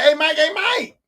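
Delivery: mock-urgent summons; upset bosses calling out. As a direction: call out to get his attention, lightly acted. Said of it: "They went in, they said".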